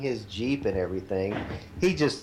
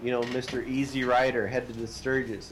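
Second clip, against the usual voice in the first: ordinary delivery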